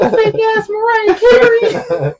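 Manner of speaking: [name]'s mock anger. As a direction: squeal the insult, half laughing. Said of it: "He gets so mad".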